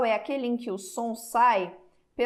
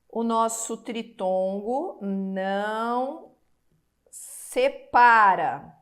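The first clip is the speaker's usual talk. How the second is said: não separa slowed, separa much louder; slow emphasis; 3 dB louder, much slower than usual. Shown as a demonstration. Teacher drilling a rule, slow and loud ending. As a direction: hammer the word separa. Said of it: "teacher stresses rule".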